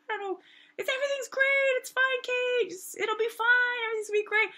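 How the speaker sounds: high-pitched carefree friend imitation; Lonnie. Friend back at the hotel reassuring her, in a high imitated voice. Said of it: "she's having fun, she's like".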